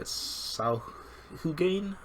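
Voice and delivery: "Sahugain" drawn out slowly as a hesitant guess, rising; unsure slow pronunciation. Shown as a demonstration. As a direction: sound out "Sahugain" slowly syllable by syllable. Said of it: "I don't know how to pronounce it".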